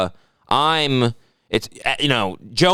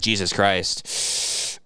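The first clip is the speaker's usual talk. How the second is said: muttered disbelief; 5 semitones lower, 5 dB quieter, much slower than usual. Retold or imitated. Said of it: "I was like".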